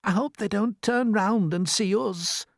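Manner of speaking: high, slow, worried voice; high-pitched and apprehensive; nervous Miss Spider. A narrator's reading. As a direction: fret in a high, anxious voice. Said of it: "Miss Spider stammered".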